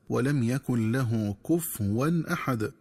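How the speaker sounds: slow verse with "كفوا" misread as "kufwan"; wrong silent fa. Shown as a demonstration. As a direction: drop the vowel after f in "كفوا". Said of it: "ومن الخطأ قراءتها بتسكين الفاء".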